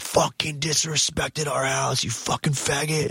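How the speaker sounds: slow, seething mock tirade; drawn-out, spat-out angry quote; retold yelling. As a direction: imitate an in-your-face guard without shouting. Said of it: "This guy's yelling at me".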